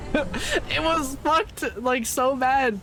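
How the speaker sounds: high, drawn-out laughing voice. Real, not acted. Telling viewers about the locked-up room card, laughing. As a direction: squeeze the words out high through laughter.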